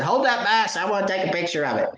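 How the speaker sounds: put-on high, fast childlike order; bossy little kid. Dad imitating his son ordering him to hold the bass, high and quick. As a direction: mimic the boy demanding a photo. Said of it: "He said".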